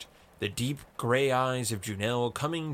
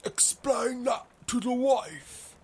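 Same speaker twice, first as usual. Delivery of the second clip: put-on high-pitched, slow old-timer voice; old explorer cutting in; 12 semitones higher, slower than usual. A narrator's reading. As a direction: cut in high and teasing. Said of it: "cut in Reefer".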